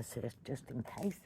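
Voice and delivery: ordinary talk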